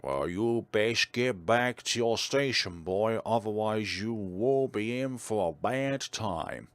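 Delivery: slow, stern officer giving orders; military officer voice. Shown as a demonstration. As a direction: order the recruit back to his post. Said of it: "I sound like some military officer".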